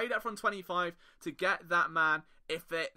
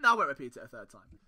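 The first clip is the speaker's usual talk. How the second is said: ordinary delivery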